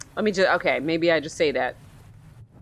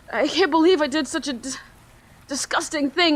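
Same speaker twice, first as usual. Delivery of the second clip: put-on higher, anguished young voice; remorseful teen boy; 7 semitones higher than usual. Teen confessing the shooting to the detective, higher and anguished. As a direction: confess it like a scared kid.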